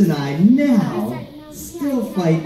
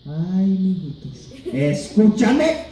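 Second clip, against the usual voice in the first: slow, drawn-out grandma plea rising higher; scolding abuela; 3 semitones higher, much slower than usual. Retold or imitated. Grandma calling fighting grandkids to listen, slow scolding. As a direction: stretch it into a commanding appeal. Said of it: "my abuela would say".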